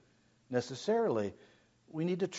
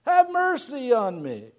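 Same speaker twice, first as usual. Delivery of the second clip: shouted high and loud, breaking into a cry; a man who cannot see crying out to Jesus for mercy, desperate shout; 12 semitones higher, 7 dB louder, slower than usual. Acted. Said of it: "he began to cry out and say".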